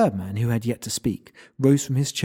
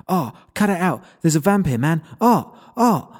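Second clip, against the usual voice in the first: higher and louder, rising panic; young man panicking at the sight of a vampire, higher and louder; panicked; 7 semitones higher, 6 dB louder than usual. Acted. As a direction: yelp it out as the terror climbs. Human panics seeing vampire.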